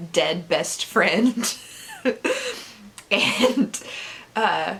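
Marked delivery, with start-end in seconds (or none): 1.9-2.6 high, squeaky giggle; real little high laugh. Real, not acted. Laughs at the plot.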